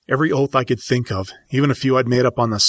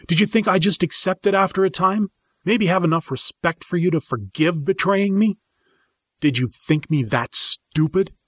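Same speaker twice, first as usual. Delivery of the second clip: high, shouted accusation; furious accusing; 9 semitones higher than usual. Acted. Student confronting sensei about betrayal, high and furious. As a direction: hurl the betrayal at him.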